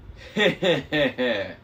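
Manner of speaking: asthmatic wheezing laugh; wheezy laugh. A narrator's reading. Bear twin laughing at the captive demigod. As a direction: laugh like having an asthmatic fit. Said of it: "His brother Aureus laughed".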